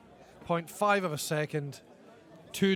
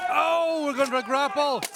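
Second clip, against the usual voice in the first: excited; 8 semitones higher, 4 dB louder than usual. Real, not acted. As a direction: pitch jumps high and loud. Commentator excited by the grapple.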